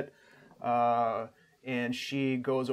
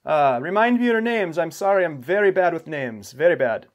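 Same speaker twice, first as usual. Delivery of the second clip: loud, higher-pitched hearty host voice; welcoming host; 5 semitones higher, 8 dB louder than usual. Acted. Game master as camp host greeting approaching guests, loud and high. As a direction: greet newcomers loudly, voice lifted. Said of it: "comes over and says".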